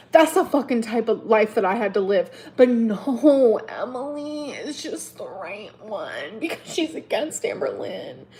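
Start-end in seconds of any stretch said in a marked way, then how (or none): 3.7-8.2 soft, slow, sneering; bitter sarcasm. Real, not acted. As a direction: drop to a bitter murmur.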